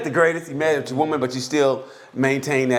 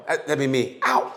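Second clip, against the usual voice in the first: ordinary delivery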